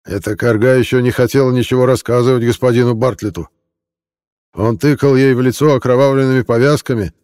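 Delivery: put-on a drunk man pouring out his grievances; bitter drunk villager. Malik's drunken complaint voiced.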